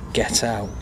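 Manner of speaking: deadpan, hushed brush-off; quiet dismissal. Acted. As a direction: send them off under your breath. Fed-up shop staff dismissing customer.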